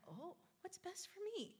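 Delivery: put-on high, soft, wondering child. Students answering the teacher, high soft child voice. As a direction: play a kid pondering the question. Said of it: "they're like".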